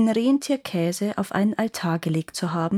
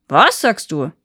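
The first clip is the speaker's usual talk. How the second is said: startled giant; 2 semitones lower, 6 dB louder than usual. Acted.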